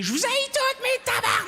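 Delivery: hammed-up furious screech, very high; wild mock tantrum at top pitch. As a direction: scream hatred at the whole room, mockingly. Imagines [name] losing it.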